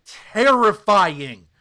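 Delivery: terrifying drawn out slow and loud; emphatic word. Real, not acted. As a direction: lean hard on terrifying. Climax of his rant about acting.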